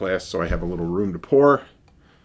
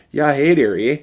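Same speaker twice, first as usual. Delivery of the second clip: a light mock Yooper accent, higher and louder; 5 semitones higher, 7 dB louder than usual. Beer reviewer joking about the can's Canadian flavor point, mock Yooper accent. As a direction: toss off a playful Upper Midwest Yooper "hey there, eh". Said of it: "Oh, I love the Yooper accent".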